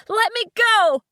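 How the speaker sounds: high, loud panicked scream; screaming at the man holding her ankle. Acted. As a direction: scream at him to let go. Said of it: "I screamed at him".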